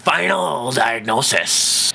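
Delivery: very slow, drawn-out proclamation; hammed-up announcer voice; mock announcer. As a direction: stretch it out like a big segment title. Announces the closing verdict segment.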